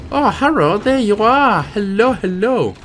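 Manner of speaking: high, sing-song hellos; playful sing-song greeting. Pretend. Player greeting a creature that has turned up, playful high greeting.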